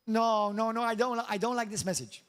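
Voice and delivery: ordinary talk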